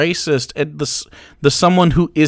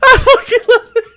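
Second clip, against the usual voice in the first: words squeezed out through laughter, high; can barely speak for laughing; real giggling delight, pitched up; 14 semitones higher than usual. Real, not acted. Streamer cracking up at troll.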